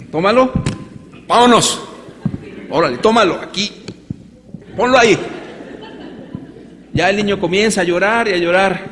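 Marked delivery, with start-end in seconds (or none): 0.1-5.2 rough angry father. Acted. Father dragging his son by the ear, shouting commands. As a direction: bark orders while dragging him by the ear. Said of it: "Lo agarro de la oreja".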